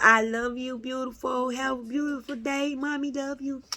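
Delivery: a quiet mommy's goodbye; tender goodbye. Real, not acted. Talking to child leaving.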